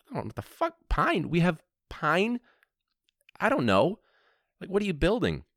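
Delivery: high-pitched, bewildered outburst; mock-panicked clueless clerk; baffled disbelief. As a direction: squeak it out, totally lost. Clueless clerk answering a customer.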